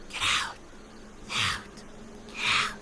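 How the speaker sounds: a ghost in the basement whispering to them to leave; ghostly whisper. A narrator's reading. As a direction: breathe the words like a faint ghost. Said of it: "Just little whispers".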